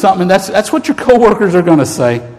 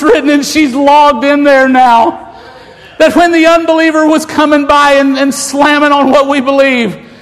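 preacher hammering home the angel's words; preaching at full force; 4 semitones higher than usual. Acted. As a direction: cry the promise out over the crowd. Preacher's climax, crying out the message.

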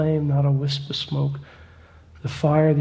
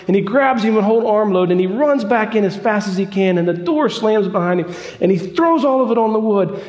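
rising, fervent preacher building the story; preaching at full force; 4 semitones higher, 3 dB louder than usual. Acted. Preacher to congregation, building fervent excitement. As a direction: drive the tale up with mounting fire.

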